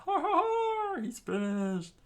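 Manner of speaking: very high, cackling pirate cry; gloating pirate scream. Acted. Pirate gloats that Luffy is finished, screaming. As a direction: shriek a villainous laugh and gloat. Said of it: "the pirate screamed out".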